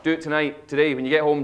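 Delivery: normal talking voice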